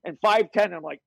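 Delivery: ordinary delivery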